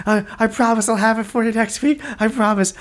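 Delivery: high, stammered pleading; acted-out panicky plea; panicking. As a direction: beg with a desperate promise. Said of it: "you see this guy who's panicking".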